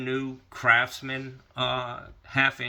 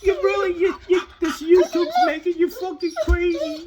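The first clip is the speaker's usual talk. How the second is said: high, louder fretting pet voice; voicing the dog; 7 dB louder than usual. Dog worrying about her owner's YouTube habit, high fretting voice. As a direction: let the dog worry out loud, squeaky. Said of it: "She's like".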